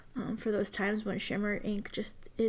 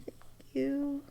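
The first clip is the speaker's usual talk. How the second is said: grateful whisper; 4 semitones higher, 4 dB quieter than usual. Real, not acted. Thanking the nib worker.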